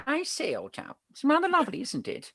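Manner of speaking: high, plummy upper-class English impression; posh English accent. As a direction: ham up a posh English aristocrat. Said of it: "I impersonate the Queen".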